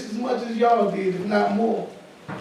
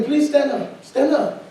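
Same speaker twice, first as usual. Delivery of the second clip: higher, eager call to stand; 6 semitones higher, slower than usual. Real, not acted. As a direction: call her up with enthusiasm. Calling woman to stand.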